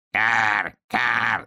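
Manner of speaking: drawn-out harsh crow caw. Acted. Crow spots flying hedgehog, cawing. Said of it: "закричала ворона".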